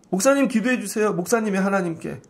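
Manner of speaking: ordinary talk, nothing marked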